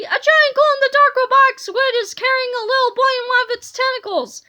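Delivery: put-on high, loud, rushed old lady. A narrator's reading. Old lady reports a giant squid to her son, loud and rushed. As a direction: rattle it off loudly as an alarmed old mother. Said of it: "said the old lady".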